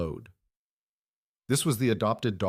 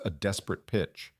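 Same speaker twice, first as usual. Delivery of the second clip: plain reading voice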